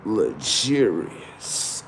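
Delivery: soft, very drawn-out word; savoured catchword. Pretend. Comic showing off his luxurious look, slow soft savouring. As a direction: stretch it out slowly and quietly.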